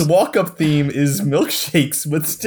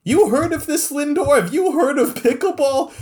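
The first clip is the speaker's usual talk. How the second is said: high-pitched mascot demanding an answer; incredulous question; 12 semitones higher than usual. Acted. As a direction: ask a teammate in high, put-on disbelief. Mascot asks Lindor in disbelief.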